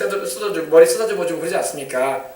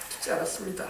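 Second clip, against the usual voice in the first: whispered echo; 12 dB quieter than usual. Shown as a demonstration.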